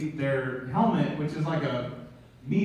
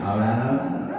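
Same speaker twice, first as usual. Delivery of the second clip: low, easygoing catchphrase impression; Matthew McConaughey; 6 semitones lower than usual. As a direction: imitate the star's relaxed signature line. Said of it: "Matthew McConaughey just got an applause break".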